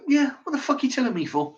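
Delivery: high, fast mock of a clerk's scornful reaction; dismissive shop clerk. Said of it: "a sort of reaction like that".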